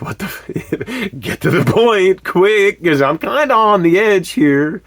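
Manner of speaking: on the edge, begging her to get to the point; mock anxious plea. Nervous husband urging his laughing wife, high and slow. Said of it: "I'm like".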